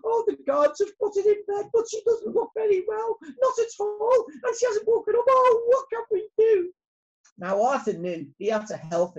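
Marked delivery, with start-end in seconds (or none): 0.0-6.7 very high, loud squeaky bee in distress; hammed-up storybook panic; panicky Lizzie Bee. A narrator's reading. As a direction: wail out the bad news.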